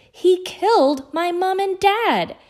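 a slow, loud, high furious roar. A narrator's reading. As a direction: roar it out in rage. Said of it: "Harry roared".

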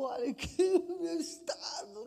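high, broken mock-weepy voice; mock tearful mess. Missionary trainer caught crying at the door, mock tearful. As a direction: act out being a sniffly wreck. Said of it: "tudo assim, tipo".

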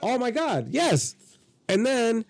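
getting giddy as he tells it; excited. Real, not acted. Excited recalling the moment.

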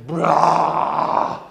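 mighty, loud lion roar; Mufasa. Acted. Father lion terrifies the hyenas, mighty roar. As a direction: let out a big, sustained roar. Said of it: "roars at them".

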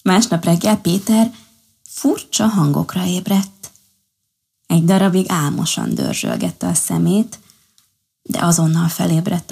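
ordinary delivery

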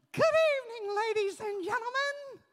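high, put-on ventriloquist doll voice; squeaky puppet greeting, proud child showing off.

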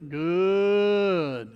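long drawn-out commercial catchphrase; slow, drawling ad slogan imitation; Al Purnell. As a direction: stretch the one word out like a sausage ad pitchman. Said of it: "What did he say?".

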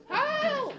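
high, thin cry for help; old lady scream. Retold or imitated. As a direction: shriek it like a frail old woman. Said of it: "The old lady, like, screams".

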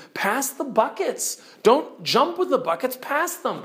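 magician giving orders. Retold or imitated. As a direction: call it out like a patient wizard. Magician instructs foolish Dufflepuds.

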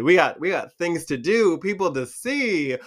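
hammed-up sassy brag; playful sass. Shown as a demonstration. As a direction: say it like you've got places to be. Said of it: "let me tell you".

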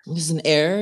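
whispered question, rising; whispering back a teasing doubt. Acted. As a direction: hush it back as a tease.

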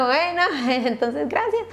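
laughing through a retold thank-you. Mother retelling her thanks to the doctor, laughing. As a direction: let the laugh break into the words.

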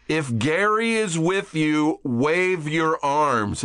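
high, slow, long-stretched loudspeaker words; helicopter PA announcement. Retold or imitated. Helicopter crew repeating the call to hikers, slow PA announcement. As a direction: imitate a crew hailing people from the air. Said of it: "someone on the P A on the helicopter".

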